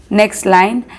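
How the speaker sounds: ordinary talk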